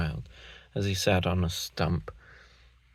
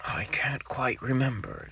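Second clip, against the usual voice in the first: whisper; 5 semitones higher than usual. Acted. Father Gruffalo hesitates.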